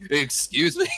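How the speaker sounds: stunned, amused double take; incredulous. Retold or imitated. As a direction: throw it back in mock shock. Retold shock at warrant news.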